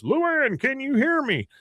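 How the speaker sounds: high call, as through a megaphone; imitating a megaphone call; Hank on megaphone. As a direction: project it up to her.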